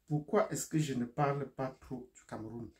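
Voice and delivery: ordinary talk, nothing marked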